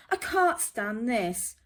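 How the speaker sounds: loud, exasperated complaint; fed-up Mister Bear. Acted. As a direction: snap it out, fed up with the noise.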